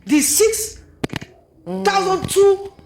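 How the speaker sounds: normal talking voice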